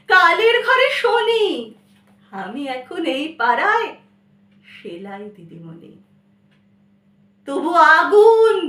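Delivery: impassioned Bengali verse reading, swinging between loud cries and hushed lines; dramatic poem recitation. Acted. Malati addressing her lost love Benimadhab, in dramatic recitation.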